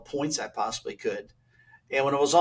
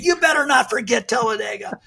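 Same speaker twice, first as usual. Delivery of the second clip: higher, louder mock shouted comeback; retold shout; 9 semitones higher, 4 dB louder than usual. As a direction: bark the challenge right back. Earnhardt's retort in quarrel.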